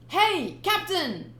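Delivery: high, loud call; alarmed crewman. Acted. Crewman calling to the captain, high loud. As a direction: shout after the captain.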